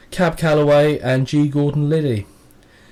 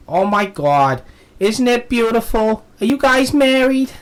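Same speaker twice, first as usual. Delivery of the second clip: put-on loud, high-pitched American lady accent; strong American accent; 11 semitones higher, 5 dB louder, slower than usual. American lady asking two British holidaymakers, strong accent. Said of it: "comes out with a really strong accent".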